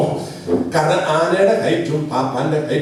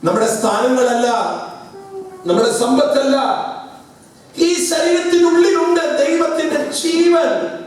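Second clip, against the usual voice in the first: preaching build; 10 semitones higher than usual. Real, not acted. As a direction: build each line until it bursts out. Sermon building to a cry. (ഉയർന്നു വരുന്ന പ്രസംഗം)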